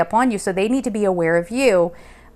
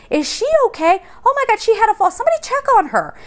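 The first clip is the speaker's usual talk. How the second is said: high, louder alarmed calling out; retold yelling; 14 semitones higher, 3 dB louder, faster than usual. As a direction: call out to others in alarm, imitating him. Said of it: "he's yelling at the people".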